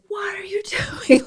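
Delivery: high-pitched incredulous cop; squeaky mock-indignant question; mock cop outburst. Cop challenging her, retold.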